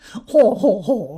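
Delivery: loud braying imitation of a silly laugh; silly haw-haw laugh. Bobby imitating Badger's silly laugh, loud and braying. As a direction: mimic a friend's silly guffaw. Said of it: "an awfully silly kind of laugh".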